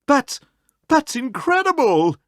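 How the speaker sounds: can hardly believe it; high, astonished stammer; amazed passers-by. A narrator's reading. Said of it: "raised their eyebrows".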